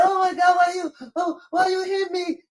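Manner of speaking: high, wailing imitation of a sobbing child; mock crying kid. Daughter crying to her dad after being smacked, mock sobbing. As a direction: whine it like a hurt little kid.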